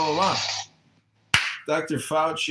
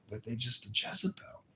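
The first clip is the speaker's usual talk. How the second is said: whisper; 11 dB quieter, faster than usual. Acted. Punchline dropped to whisper.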